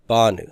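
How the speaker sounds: "banu" accented on "ba"; BA-nu, front-stressed "banu"; stress on first syllable. Shown as a demonstration. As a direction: hit the "ba" of "banu", lighter "nu". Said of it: "accent on that first syllable".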